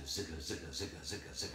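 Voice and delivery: soft, low rhythmic "zigga" noise; hushed, low, repetitive sound effect; imitated road lines. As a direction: patter out a quiet repeating zigga.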